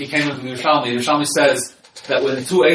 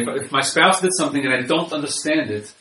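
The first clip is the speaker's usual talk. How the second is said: normal talking voice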